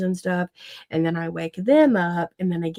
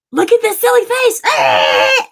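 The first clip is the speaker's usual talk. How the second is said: put-on high, loud taunting kid shout; mock child's yell; 14 semitones higher, 9 dB louder, slower than usual. Mother imitating daughter after hitting brother, loud and silly. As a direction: imitate a child shrieking to distract a sibling. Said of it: "and she'll be like".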